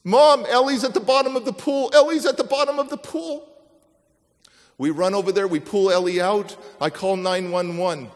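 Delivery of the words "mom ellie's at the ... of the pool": high, loud alarmed yell; panicked shout. Retold or imitated. Son shouting to his mom about his sister, high panicked yell.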